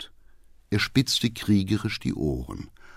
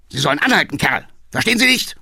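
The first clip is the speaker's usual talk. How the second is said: angry order, climbing much higher at the end; furious shout; 5 semitones higher, 3 dB louder, much faster than usual. A narrator's reading. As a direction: bellow at the coachman to obey. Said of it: "schrie der Alte kirschrot vor Wut". (wütend schreiend)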